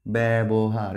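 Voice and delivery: "ব্যবহার" with drawn-out bæ; open bæ vowel. Shown as a demonstration. Teacher shows the remaining words' sound, "ব্যবহার" as long bæ-bohar. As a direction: stretch the opening of "ব্যবহার" as bæ. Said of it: "সব তোমরা ব্যা উচ্চারণ করবা".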